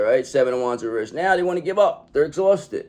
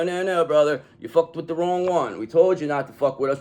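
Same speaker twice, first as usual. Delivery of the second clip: ordinary delivery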